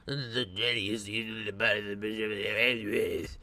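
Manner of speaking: low, croaky imitation; frog voicing. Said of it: "frog voicing".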